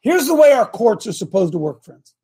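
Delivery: normal talking voice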